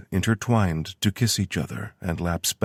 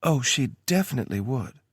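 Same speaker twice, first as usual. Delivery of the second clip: lightly higher woman's voice; Tara voice; 8 semitones higher than usual. A narrator's reading. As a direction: read her lines a bit higher. Tara's voice in story.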